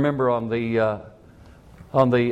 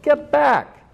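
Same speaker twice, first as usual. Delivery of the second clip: high sharp warning yell; 9 semitones higher, slower than usual. Retold or imitated. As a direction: shout him back from the gasoline. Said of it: "I yelled at that man".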